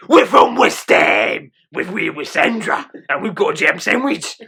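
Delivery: loud slow Cockney shout; Cockney accent. Acted. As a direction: bellow it like a West Ham Cockney. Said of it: "That's my Cockney accent".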